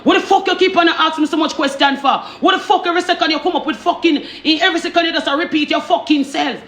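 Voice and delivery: high-pitched, rapid mock tirade; man turning on her. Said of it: "And the man turn on".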